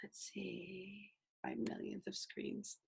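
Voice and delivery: very soft, whispered aside; talking to oneself. Real, not acted. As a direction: murmur to yourself while searching. Talking to herself while screen sharing.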